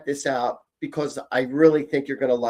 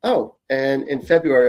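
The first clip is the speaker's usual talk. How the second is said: normal talking voice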